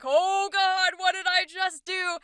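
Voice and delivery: high, loud "oh no" reaction; mock alarm. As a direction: play up the summoner's alarm. Imagined summoner panics at creature.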